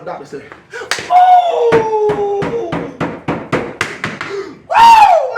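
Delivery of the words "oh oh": long, high, loud "oh"; horrified howl. Retold or imitated. Doctor recoiling during exam, hammed-up long howl. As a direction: howl in exaggerated horror.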